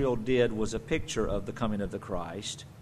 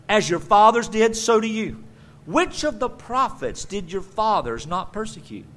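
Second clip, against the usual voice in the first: fiery rebuke; 5 semitones higher, 5 dB louder than usual. Acted. Preacher voicing Stephen's rebuke.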